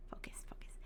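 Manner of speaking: breathy whisper to herself. Real, not acted. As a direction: hush it to yourself. Self-coaching after fumbling.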